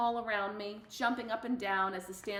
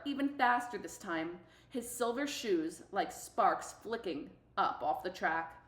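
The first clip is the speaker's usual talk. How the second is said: ordinary delivery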